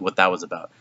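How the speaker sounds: normal talking voice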